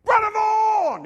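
high, loud joyful yell from far off; hammed-up shout of greeting; imitated yell. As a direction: call out across the mall at full voice. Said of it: "He yells at me".

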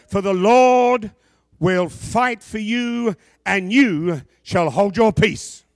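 loud, slow, ringing proclamation; preaching at full force. Acted. Preacher booming Moses' words to the congregation, slow and loud. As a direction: thunder out Moses' command.

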